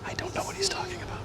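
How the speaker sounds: whispering to the viewers; whispered aside. Real, not acted. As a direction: hush it as a secret aside. Aside to viewers about son.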